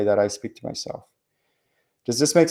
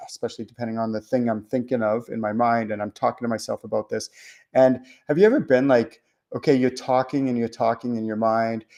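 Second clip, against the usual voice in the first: usual speaking voice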